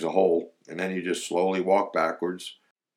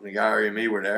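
usual speaking voice

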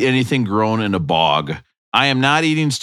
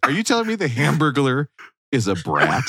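ordinary delivery

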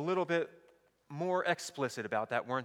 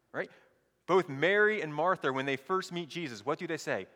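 usual speaking voice

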